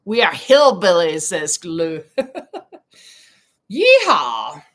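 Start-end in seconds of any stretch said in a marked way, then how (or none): 3.7-4.6 loud playful hillbilly holler; mock hillbilly yeehaw. Host joining Lou's joke about being hillbillies, mock hillbilly whoop.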